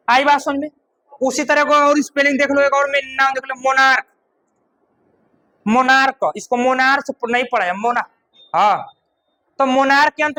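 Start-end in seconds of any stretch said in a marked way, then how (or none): none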